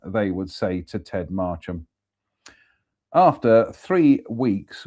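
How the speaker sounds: ordinary delivery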